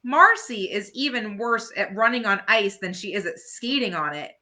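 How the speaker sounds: ordinary delivery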